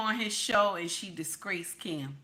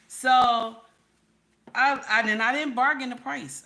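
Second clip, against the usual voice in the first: emphatic; 5 semitones higher, 8 dB louder than usual. Real, not acted. Emphatic insistence.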